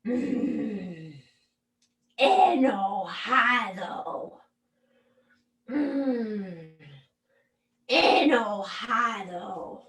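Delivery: slow, grumbled "Enojado"; grumbling anger. Shown as a demonstration. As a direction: drag it out sulkily. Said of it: "Also not a fun sentimiento". (enojado, arrastrado y gruñón)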